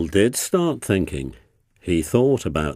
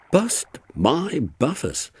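exclaiming; 7 semitones higher than usual. A narrator's reading. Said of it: "exclaimed Rusty".

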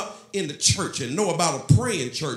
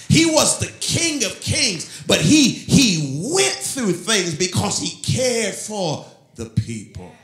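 impassioned preaching; 3 semitones higher, 6 dB louder, slower than usual. Real, not acted. Pastor telling the church how Jesus cared, impassioned preaching. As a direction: preach it out, stretching the key words.

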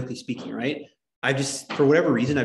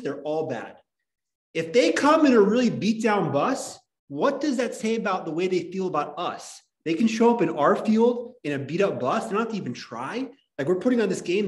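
fake fired-up coach; 4 semitones higher than usual. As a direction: pump up the team like a cheesy coach, half-joking. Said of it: "really fake fired up, and he's like".

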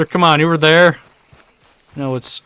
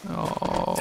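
usual speaking voice

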